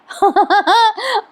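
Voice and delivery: high giggly burst; amused high-pitched laughter; real laugh. Real, not acted. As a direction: laugh helplessly at the story. Laughs at slapping scene.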